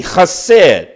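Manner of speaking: chesed repeated with the rasped kh, KHAH-sed, falling; Hebrew word chesed. Shown as a demonstration. Pastor repeating the Hebrew word after spelling it, rasped kh. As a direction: repeat chesed slowly, throaty kh at the start. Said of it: "C H E S E D".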